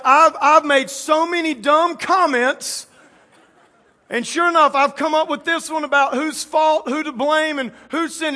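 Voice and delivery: imitated Peter blurting it out; acted disciple Peter. Voicing Peter's panicked confession in story.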